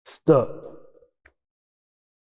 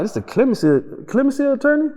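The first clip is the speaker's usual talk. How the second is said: surprised; 12 semitones higher, faster than usual. Retold or imitated. On the phone with the attorney, surprised. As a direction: pitch jumping up in surprise. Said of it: "I'm like".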